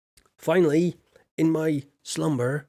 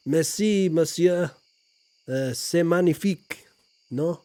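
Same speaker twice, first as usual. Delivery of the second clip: louder, higher hammed-up French accent; hammy French; 3 semitones higher, 4 dB louder than usual. Shown as a demonstration. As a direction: thank the viewer with a proud French accent. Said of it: "my accent in French is very good".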